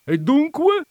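high, loud triumphant exclamation. A narrator's reading. Visitor catching out the countess, triumphant. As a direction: pounce as if the case is won. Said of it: "esclamò il visitatore in tono di trionfo".

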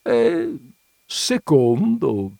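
slow, sighing reluctant concession; a grudging sigh over the word secondo. A narrator's reading. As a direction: let it out on a drawn-out sigh. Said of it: "sospirò".

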